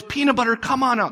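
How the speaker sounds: sweet grandma coaxing. Retold or imitated. Grandma gently coaxing the boy out from hiding. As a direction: coax him out sweetly. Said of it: "grandma comes and she goes".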